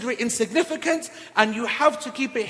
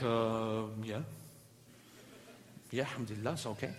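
much softer, low and hesitant, barely getting the words out; awkward reluctant mumble; 13 semitones lower, 16 dB quieter than usual. Retold or imitated. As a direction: shrink away from the question, mumbling. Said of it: "Brother's like".

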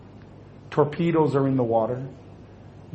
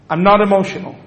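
loud, higher mock-indignant protest; defensive denial; 5 semitones higher, 8 dB louder, faster than usual. Husband denying his feelings to his wife, loudly defensive. Husband denies having any emotion.

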